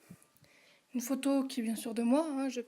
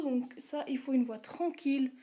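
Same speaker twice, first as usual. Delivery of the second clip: usual speaking voice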